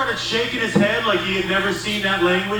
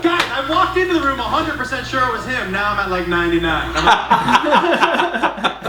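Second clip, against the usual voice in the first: ordinary delivery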